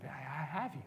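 very soft, hushed comfort; calm a frightened child, barely above a whisper; retold fatherly reassurance. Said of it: "and I heard him".

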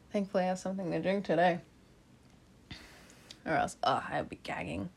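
soft, breathy whispered aside. Real, not acted. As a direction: drop to a whisper.

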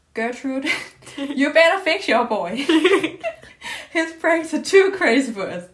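hammed-up Polonius, laughing through it. Student voicing Polonius to the queen, loud and laughing. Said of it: "So, Polonius".

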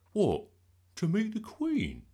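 high, loud astonished question; amazed boy; wide-eyed amazement. A narrator's reading. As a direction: ask it in awe, voice shooting up. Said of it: "asked Bertie wide-eyed".

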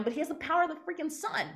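ordinary delivery

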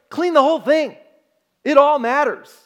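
loud, high, insistent call to clean it all; emphatic divine command. Acted. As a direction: boom it out like God laying down the law. Pastor voicing God's demand.

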